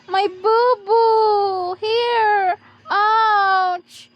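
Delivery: playful pretend whimper, long stretched words; mock hurt wailing. Parent playing hurt for the child, mock wailing. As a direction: wail over a pretend boo-boo, drawn out.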